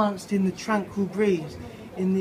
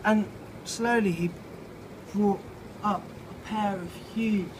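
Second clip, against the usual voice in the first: ordinary delivery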